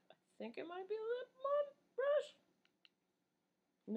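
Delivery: very high, slow squeaky voice. Acted. Musing to her viewers about a brush, in a slow squeaky high voice. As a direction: squeak it out slowly in a tiny high voice.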